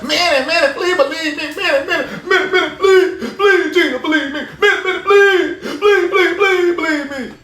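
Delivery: high, laughing mock plea; hammed-up pleading; mock begging. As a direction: whine and beg like a desperate fan. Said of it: "They'll come up and scream like".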